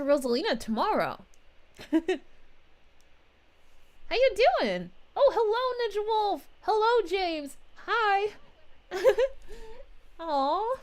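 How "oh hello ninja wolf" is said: ordinary talk, nothing marked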